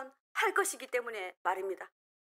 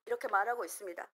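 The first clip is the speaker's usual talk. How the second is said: ordinary talk, nothing marked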